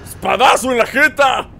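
triumphant taunt. Real, not acted. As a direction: crow it at the enemy as you strike. Triumphant taunt while attacking Ganon. (burla triunfal a gritos)

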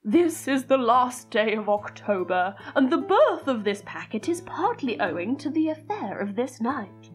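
put-on deliberate, theatrical narration of an old letter; dramatic letter reading. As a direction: read it like an old diary entry, savouring words. Reads old letter over music.

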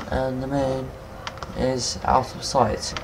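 lightly posh, slow and soft English accent; a touch of plummy English accent on the moon; mild posh English accent, drawn out. Shown as a demonstration. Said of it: "I said that really posh".

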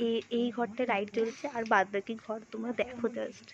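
hushed, near-whisper narration; whispering on the phone. Real, not acted. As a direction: keep your voice down. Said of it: "ওই জন্য আমি আস্তে কথা বলছি". (ফোনে ফিসফিস করে)

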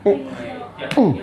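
ordinary delivery

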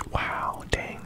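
usual speaking voice

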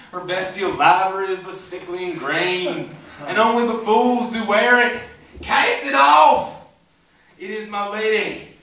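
loud, high, slow Southern declaiming; Southern-accented Shakespeare. Acted. Comic reciting Romeo's speech to the crowd, hammed-up Southern accent. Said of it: "reverse it with a Southern accent".

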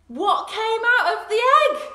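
high, loud astonished gasp; excited gasp. Acted. The egg finally hatches before children, high excited gasp. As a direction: burst with amazement. Big surprise reveal.

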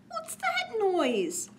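put-on nervous child.

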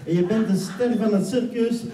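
lively stage hypnotist painting a circus; hypnotic scene narration. Acted. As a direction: sweep them into the circus scene.

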